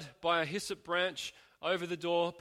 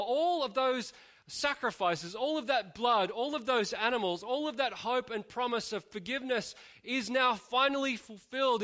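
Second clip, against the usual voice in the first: higher, building sermon climax; rising preaching; 5 semitones higher than usual. Acted. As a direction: preach it home with growing fervour.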